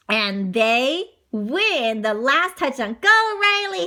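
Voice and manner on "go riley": high, loud rooting; cheer. A narrator's reading. Teammates urging Riley to the touchdown, excited cheer. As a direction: cheer your runner on. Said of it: "his teammates cheer".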